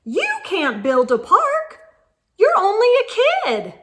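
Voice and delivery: a hint of a brushing-off official; dismissive clerk. Clerk brushing off the little girl, lightly voiced and dismissive. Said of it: "the clerk said what no one else did".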